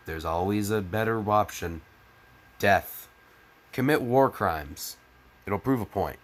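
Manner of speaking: usual speaking voice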